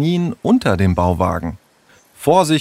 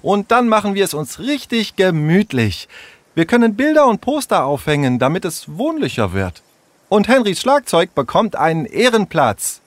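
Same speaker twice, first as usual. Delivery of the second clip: put-on much higher, bright girl handing out tasks; bossy girl Tessa; 10 semitones higher than usual. Tessa tells Henry and Tom what to fetch, high and brisk girl's voice. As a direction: take charge like the clever girl of the gang.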